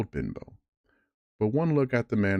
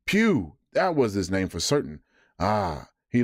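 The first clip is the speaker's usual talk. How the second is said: excited cry. Acted. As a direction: leap on the name with glee. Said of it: "now quite excited".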